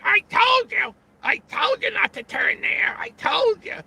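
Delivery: shrill furious yell at the husband; nagging wife shout. Retold or imitated. Wife yelling at her husband over a wrong turn. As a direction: scream it like a wife fed up in traffic. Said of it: "the wife is going".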